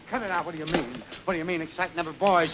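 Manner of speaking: ordinary delivery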